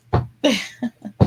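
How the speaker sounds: short laugh at herself; giggling laugh. Real, not acted. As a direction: laugh at your own silliness. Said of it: "I'm being silly".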